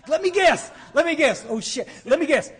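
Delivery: loud, high sarcastic shouting; comic exasperated yelling; mocking outburst. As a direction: shout the mock guess, drawing out the last words. Mock outburst cutting off meeting talk.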